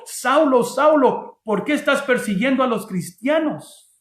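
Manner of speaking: high, loud accusing call; hypothetical heavenly voice. Acted. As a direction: call out the name, then press the question. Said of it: "La voz no dijo".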